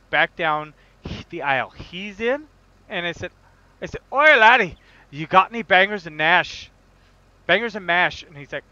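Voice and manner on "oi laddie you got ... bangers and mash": high, loud, hammed-up British; put-on British accent. Asking a stranger in Walmart for food, put-on British accent. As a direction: call out in a fake British accent. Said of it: "I totally changed out, out, out, out of any accent".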